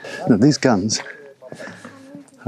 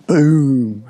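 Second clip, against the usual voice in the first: loud, punchy boom with a higher pitch; exclaimed boom; 5 semitones higher, 5 dB louder than usual. Shown as a demonstration. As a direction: shout boom like a cannon going off. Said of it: "It was like "boom" with an exclamation mark".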